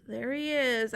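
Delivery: ordinary delivery, nothing marked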